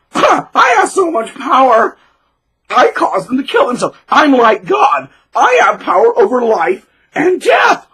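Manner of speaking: high, loud, slow crying shout of power; mock gloating tyrant. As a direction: gloat over the power of life and death.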